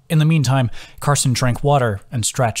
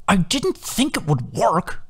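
slow, higher, exasperated admission; quiet exasperation; 9 semitones higher, slower than usual. Acted. Carson confessing to Adam, quietly exasperated. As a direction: let the frustration leak out slowly. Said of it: "with quiet exasperation".

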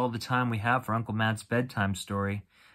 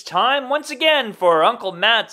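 big loud show announcer; 12 semitones higher, 11 dB louder, slower than usual. Acted. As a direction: boom it out like a TV host. Announcer intro, loud and high.

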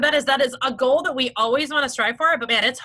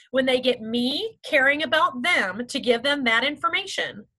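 ordinary delivery

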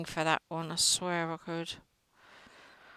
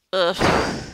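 disgusted groan; 8 dB louder than usual. Real, not acted. Annoyed at Ruby's rudeness.

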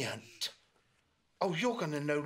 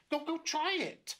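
raised, eager little voice; high urging voice; 14 semitones higher than usual. Acted. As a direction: push them to go, up high. Roo urges platypus.

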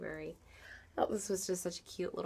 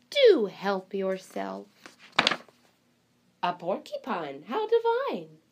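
high, bright-eyed librarian voice, louder throughout; storybook librarian welcoming animals; fussy librarian; 7 semitones higher, 8 dB louder than usual. Acted. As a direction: play the flustered, delighted librarian. Librarian voice reading picture book.

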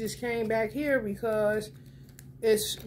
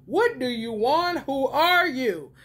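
normal talking voice

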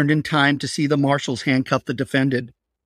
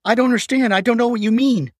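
panicked confusion; 9 semitones higher than usual. Acted. Patricia to her captor, panicked and high. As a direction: cry out, terrified and lost. Captive panicking under knife.

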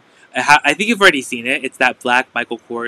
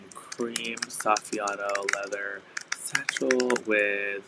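dramatic; 2 semitones lower, 8 dB quieter, slower than usual. Acted. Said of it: "Did I sound dramatic".

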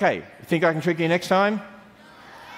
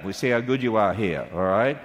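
usual speaking voice

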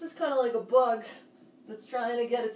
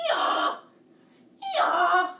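loud, high hee-haw bray; donkey bray; 8 semitones higher, 9 dB louder than usual. Shown as a demonstration. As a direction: bray along with the class, full voice. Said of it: "Let's hear it".

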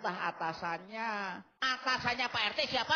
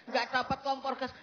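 usual speaking voice

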